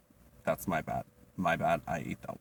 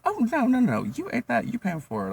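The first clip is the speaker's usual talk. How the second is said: much higher, louder mocking singsong; sassy refusal; 14 semitones higher, 4 dB louder than usual. Server refusing a customer who won't pay, high sassy. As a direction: sassily shut the customer down. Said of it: "and I'm like".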